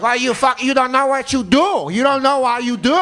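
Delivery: high-pitched mock Filipino-accented scolding; yelling in Filipino accent. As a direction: imitate angry Filipino workers scolding. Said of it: "they just yell at me".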